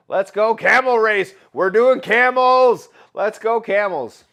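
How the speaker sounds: loud, high hype call; rally the chat to race; hyped shout. Real, not acted. Hyped announcing camel race.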